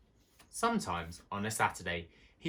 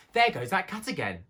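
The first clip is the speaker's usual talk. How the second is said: higher, louder sudden exclamation; exclaiming; 10 semitones higher, 5 dB louder than usual. A narrator's reading. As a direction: blurt it out in alarm. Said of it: "exclaimed Johnny Town Mouse".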